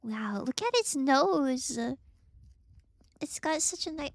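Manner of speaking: normal talking voice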